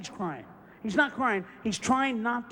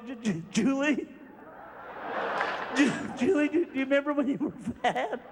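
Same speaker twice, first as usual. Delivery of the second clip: stifling laughter. Retold or imitated. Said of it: "He'll go".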